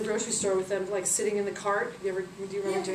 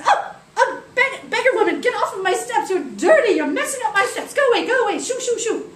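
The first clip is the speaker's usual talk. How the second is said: high, loud outraged rich lady; indignant shooing; 11 semitones higher, 9 dB louder than usual. Acted. Wealthy woman shooing beggar off her steps, indignant scolding. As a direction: shriek at them to get away, hammed up.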